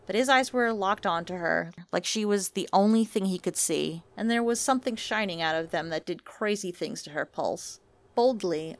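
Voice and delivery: ordinary delivery, nothing marked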